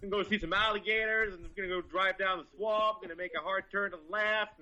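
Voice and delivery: put-on high, hollered West Florida accent. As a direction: play a Southern swamp-boat guide calling to passengers. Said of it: "can you do his accent at all".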